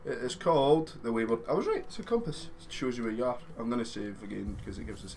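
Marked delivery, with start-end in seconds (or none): 1.5-2.5 high, pleased I-told-you-so; triumphant. Real, not acted. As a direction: crow about being right. Triumph at being right.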